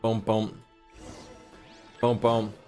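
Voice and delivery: ordinary talk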